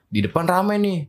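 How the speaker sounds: ordinary talk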